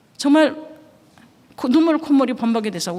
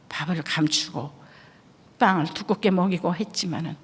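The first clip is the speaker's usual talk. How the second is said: soft voice of God; 5 semitones lower, 6 dB quieter than usual. Retold or imitated. God gently naming the wife's faults, hushed. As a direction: lower and quietly grave.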